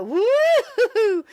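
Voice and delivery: high, loud whoop of glee; excited whoop. Real, not acted. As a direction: let out a loud gleeful whoop. Exclaiming after the clip ends.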